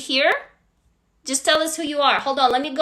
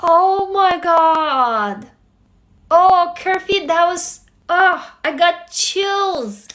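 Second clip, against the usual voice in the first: slow, higher, drawn-out awe; deeply moved; 4 semitones higher, much slower than usual. Real, not acted. Streamer praising Kerfi's story that gave chills, moved and slow. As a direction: linger on each word, overcome with admiration.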